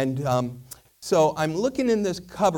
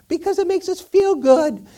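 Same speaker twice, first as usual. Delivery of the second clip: put-on loud, high girlish retort; girl candle; 9 dB louder than usual. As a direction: insist in a loud squeaky voice. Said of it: "and she goes".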